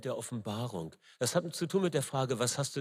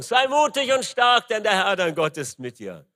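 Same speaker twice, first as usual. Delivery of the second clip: much higher and louder, a ringing call to courage; proclaimed encouragement; 7 semitones higher, 11 dB louder than usual. Acted. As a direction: shout it like a charge to Joshua.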